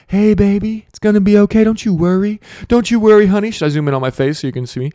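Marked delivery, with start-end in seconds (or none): 0.1-3.5 higher, louder crooning mock sweet-talk; soothing Powell voice. Powell soothing the markets, mock crooning sweet-talk. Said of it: "talking in a voice like this".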